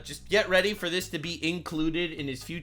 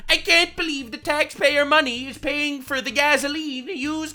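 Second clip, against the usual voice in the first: much higher whiny mock complaint; mocking whiny liberal; 8 semitones higher, 4 dB louder than usual. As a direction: whine it like a petty critic. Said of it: "liberals shitting themselves on Twitter saying".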